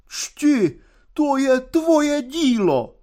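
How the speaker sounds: high, slow tragic declamation. A narrator's reading. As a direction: draw it out high like a stage tragedian. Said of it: "řekl mu tragickým hlasem".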